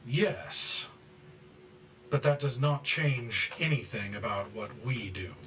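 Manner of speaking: loud, deliberate ultimatum; stern threat. Acted. High priest threatens followers with a cleansing, stern warning. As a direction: warn them like a leader who won't be questioned.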